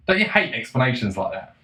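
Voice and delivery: ordinary delivery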